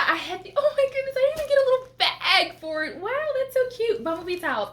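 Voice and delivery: high, giddy, laughing gush over a gift; thrilled unboxing squeal; excited. Real, not acted. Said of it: "calm down, [name]".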